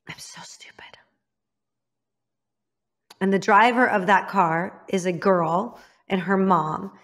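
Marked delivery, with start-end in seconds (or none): 0.1-1.0 breathy whisper of regret, very soft; hushed, bitter whisper; whispered self-reproach. Real, not acted. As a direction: whisper it to yourself, ashamed. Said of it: "whispered self-reproach".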